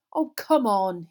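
loud, exasperated urging; fed-up pleading; impatiently. A narrator's reading. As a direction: push with open impatience. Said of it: "he said impatiently".

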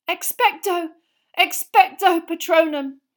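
high, loud repeated incantation; desperate shout. A narrator's reading. Harry alone against the Dementors, shouting desperately. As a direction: shout the spell, fighting on.

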